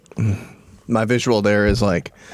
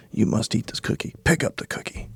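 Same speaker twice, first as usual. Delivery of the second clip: hushed, coaxing order; Anna coaxing him to eat the cookie, whispered; whispered command; 7 dB quieter than usual. Retold or imitated. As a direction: whisper it like a tempting secret. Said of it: "she's like".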